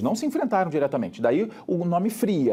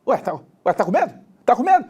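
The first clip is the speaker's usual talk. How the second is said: rising, mock street-fight goading; playful suburban challenge; suburban tough guy taunt; 6 semitones higher, 5 dB louder than usual. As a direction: goad a rival, getting higher and louder. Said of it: "eu já imagino quase assim".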